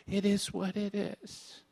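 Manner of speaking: quiet, slow, disdainful mock of the phrase; a hushed sneer at a hated saying; scornful quote. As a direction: say "It is what it is" softly, dripping with contempt. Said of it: "I hate that sta".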